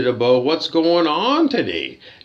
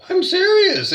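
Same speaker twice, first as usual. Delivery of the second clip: much higher, pushed out hard; emphatic insistence; 14 semitones higher, 4 dB louder than usual. Real, not acted. Guest insisting the hotel ignores vegetarians, high and emphatic. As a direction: really insist on it.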